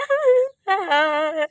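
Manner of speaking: long laugh at someone's expense; mocking laugh. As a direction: laugh mockingly at your sister-in-law. Laughs at sister-in-law's makeup. (मज़ाक उड़ाती हँसी)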